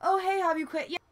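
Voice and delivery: imitating an annoyingly cheerful acquaintance; mock-chirpy friend asking if she quit; chirpy nagging friend. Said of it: "they're like".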